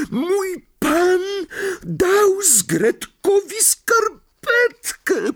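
very high, slow, squeaky elf voice in wonder; a tiny creature stunned by a gift; amazed house elf. Acted. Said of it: "powiedział zdumionym tonem".

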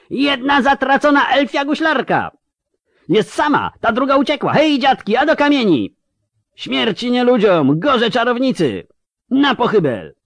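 very high, ringing metallic shout; a piercing agitator calling from the crowd. Acted. A voice from the crowd rouses people against the witch, ringing and metallic. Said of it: "dźwięczny i metaliczny głos".

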